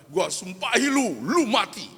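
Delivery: loud, high, slow father's curse; acted angry curse. Father curses the son who walked out, acted angry shout. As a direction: hurl it as an enraged father would.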